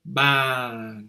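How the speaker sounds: ban falling, meaning table; long falling tone. Shown as a demonstration. Teacher contrasts the falling tone of ban. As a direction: let ban fall slowly. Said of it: "Ton qui descend".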